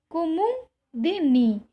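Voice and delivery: कुमुदिनी said slowly, every syllable clear; कुमुदिनी drawn out syllable by syllable, दि clear; careful spelling-out. Shown as a demonstration. Teacher slowly reads कुमुदिनी for the class. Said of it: "सही तरीका है लिखने का वो है कुमुदिनी".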